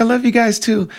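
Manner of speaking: injured friend answers visitors, high called-out imitation; imitating Julie. Friend answers from behind curtain.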